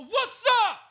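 very high loud challenge; challenging shout. Retold or imitated. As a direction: dare him to come at you. Shouting back at rival fan.

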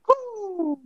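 shrill celebratory woo; high victory whoop. Real, not acted.

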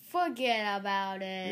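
slow, drawn-out "forget about it"; quoting a gangster film slowly; mobster movie line. Retold or imitated. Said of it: "What's that from?".

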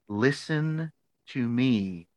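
drawn-out words with weighty pauses; mock-stern, very slow; slow, deliberate lecturing. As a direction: spell it out slowly, imitating an insistent mentor. Retold advisor leans in, insisting.

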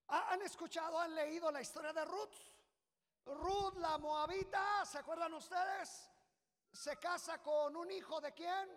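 high, loud pulpit shout; preaching at full force. Acted. Preacher shouting the story of Ruth to the church. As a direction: call the story out to the crowd.

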